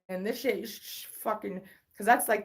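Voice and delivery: ordinary talk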